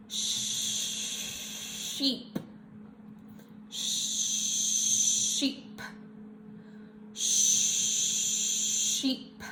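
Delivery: Sheep with a long held sh. Shown as a demonstration. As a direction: stretch the sh in Sheep. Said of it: "hold out your sh sound".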